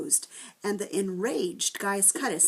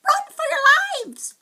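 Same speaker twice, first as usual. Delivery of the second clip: much higher and louder, slow, frantic scream; screaming warning; 12 dB louder than usual. A narrator's reading. Wiffle Bird screams to the fleeing children, a slow frantic shriek. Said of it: "the Wiffle Bird screamed".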